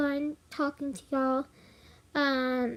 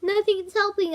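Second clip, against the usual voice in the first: panicked; 6 semitones higher, 6 dB louder than usual. Retold or imitated. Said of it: "He's like".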